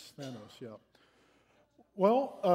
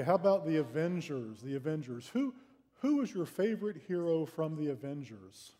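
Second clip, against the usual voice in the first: ordinary talk, nothing marked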